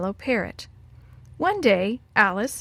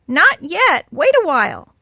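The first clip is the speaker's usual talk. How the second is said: high, loud squawked refusal; parrot squawk; 7 semitones higher, 9 dB louder, slower than usual. A narrator's reading. Parrot refuses Alice's request, squawking loudly.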